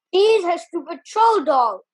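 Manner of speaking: disgusted. Acted. Giles explaining the doll, disgusted. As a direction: sneer at the fuss. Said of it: "Gil said disgustedly".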